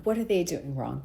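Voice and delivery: "What are they doing wrong?" dropped to a soft aside; quiet repeat. Shown as a demonstration. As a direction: mutter the question to yourself. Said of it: "That is more of what's going on".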